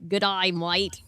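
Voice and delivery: loud, hammed-up Aussie greeting; corny Australian accent. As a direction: put on a cheesy Australian accent. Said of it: "very corny Australian accent".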